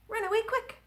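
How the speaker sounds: zip it up high; quick. Shown as a demonstration. Children's rhyme, quick line sung high.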